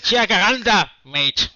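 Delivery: loud, high Aussie twang on Jacaranda, mate; Australian accent. Pretend. Asked by a player, says Jacaranda in an Australian accent. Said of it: "Say it in an Australian accent if you can".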